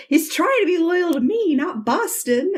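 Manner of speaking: high-pitched mock protest; exasperated son. Son defends boyfriend against mother.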